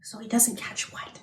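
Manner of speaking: soft unvoiced whisper. Asking the child a secret, whispered.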